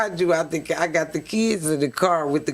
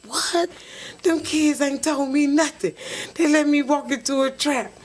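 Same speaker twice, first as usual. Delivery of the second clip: shocked outrage; 9 semitones higher than usual. Retold or imitated. Learning kids knew.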